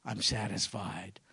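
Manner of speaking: soft, low, satisfied; contented sigh. Pretend. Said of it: "steps back and says".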